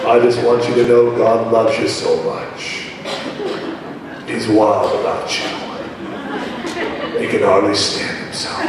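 mock a stiff, lifeless believer; low, flat-toned mock piety; stoic pious brother. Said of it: "reduce your concept of joy down to stoic peace".

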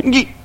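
sudden high squeal as if nipped; startled yelp. Retold or imitated. Pastor acting out a sheep bite, high startled yelp. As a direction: yelp like something just bit you. Sheep nips leader's butt.

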